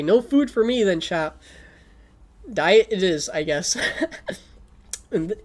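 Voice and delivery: normal talking voice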